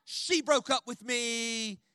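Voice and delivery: slow, loud, hammed-up sobbing plea; mock wailing. Teen son wailing to his dad, mock sobbing. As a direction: wail it out like a heartbroken teen. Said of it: "I was like".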